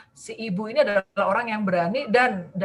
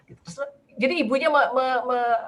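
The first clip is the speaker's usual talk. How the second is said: ordinary talk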